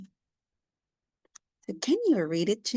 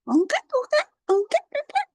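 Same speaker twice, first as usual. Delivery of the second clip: high, loud monkey call; monkey noise; 5 dB louder, much faster than usual. Shown as a demonstration. Teacher makes monkey noises so James can guess. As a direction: chatter like a playful monkey.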